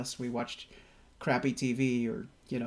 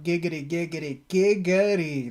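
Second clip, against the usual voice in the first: giggity catchphrase; 2 semitones higher, 3 dB louder than usual. Retold or imitated. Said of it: "And I was like".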